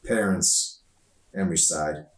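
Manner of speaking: ordinary delivery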